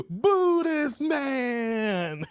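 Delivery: very slow, stretched "Boo this man" through laughter; drawn-out boo. Retold or imitated. Quoting the movie's boo while laughing, very drawn out. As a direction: drag every word out while cracking up. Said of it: "And then dude's like".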